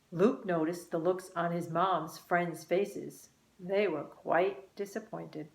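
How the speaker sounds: ordinary delivery, nothing marked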